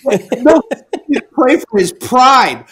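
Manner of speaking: high, laughing through the words; can't hold back the laugh. Real, not acted. Laughing to the host while retelling the prayer circle.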